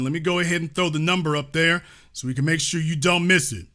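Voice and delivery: ordinary talk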